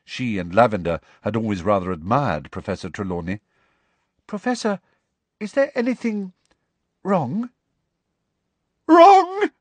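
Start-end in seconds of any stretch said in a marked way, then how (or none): 4.3-7.5 put-on higher, gentle girl's voice; Parvati, concerned. A narrator's reading. Parvati asking Trelawney, higher and concerned. 8.9-9.5 very high, very loud wounded Trelawney; throbbing with emotion. A narrator's reading. Trelawney answering Parvati, loud and throbbing. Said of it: "in a voice throbbing with emotion".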